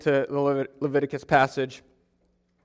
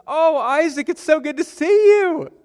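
high, drawn-out delighted squeal, imitated; gushing greeting; 12 semitones higher, 3 dB louder, slower than usual. Woman greeting the preacher at family Christmas, gushing. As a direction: gush like a relative at a reunion. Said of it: "I acted all excited to see her".

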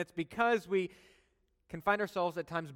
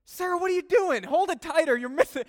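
loud, high panicked yell at the helper; angry yell; 13 semitones higher, 10 dB louder than usual. Retold or imitated. Pastor yelling at Sarah over spilled gasoline. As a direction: shout at her to hold it tighter. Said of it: "I got so angry at Sarah".

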